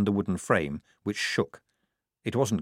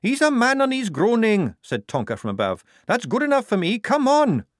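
much higher, louder brisk voice; brisk Tonka; 13 semitones higher, 6 dB louder than usual. A narrator's reading. Tonka from above offering the squad a hand, high and brisk. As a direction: wave the others up impatiently.

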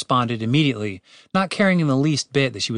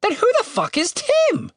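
very high exasperated bird voice; squawky-high frustrated demand. A narrator's reading. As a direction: burst out as a fed-up bird. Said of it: "ruffled his feathers in frustration".

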